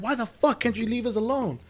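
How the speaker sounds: high-pitched imitation of an annoyed girlfriend; snapping. Girlfriend snaps at his friends to leave, imitated annoyed snap. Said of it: "she gets up and she snaps".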